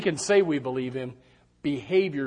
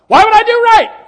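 mock indignant protest; 16 dB louder than usual. Congregant arguing with preacher.

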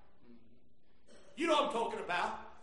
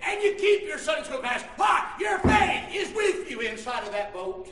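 driving, insistent pulpit exhortation; full-force preaching; 2 semitones higher, 5 dB louder than usual. Real, not acted. Preacher urging the deserted spouse to stay faithful, full-force preaching. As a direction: build phrase on phrase, pressing the congregation onward.

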